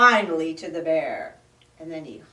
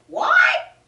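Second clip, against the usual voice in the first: very high, loud yelp; yelling; 10 dB louder than usual. Acted. Muskrat yells back, can't hear over noise. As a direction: yell it over the din. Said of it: "yelled the Muskrat".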